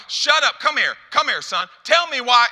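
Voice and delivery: loud, high-pitched angry order to a son; angry father. Retold or imitated. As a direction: shout it like a fed-up dad. Angry father snapping at his son.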